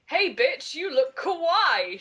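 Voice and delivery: high, loud, slow mock girly gush; gushing friend. Friend reads Willow greeting the heroine, mock girly gush. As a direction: stretch it out like a gushing teen girl. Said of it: "she said".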